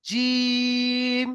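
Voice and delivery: জিম held very long on one even pitch; teacher holding the ই in জিম for three counts; long drawn-out vowel. Shown as a demonstration. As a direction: stretch জিম to three alif lengths. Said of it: "তিন আলিফ পরিমাণ টানেন".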